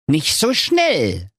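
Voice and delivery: slow, high dwarf voice; teasing ghost dwarf. Acted. Dwarf ghost refuses to take the miser home, teasing. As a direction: hold him back, drawing it out.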